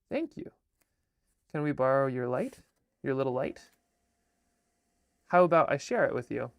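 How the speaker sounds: lively storybook reading with voices; reading her picture book aloud; reading to children. Acted. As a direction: read it like bedtime to kids.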